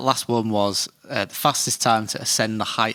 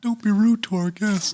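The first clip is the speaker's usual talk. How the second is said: high, slow, effeminate put-on voice; Ed Gein; 11 semitones higher, slower than usual. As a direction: tease in a creepy high voice. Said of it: "I keep doing it to Danielle".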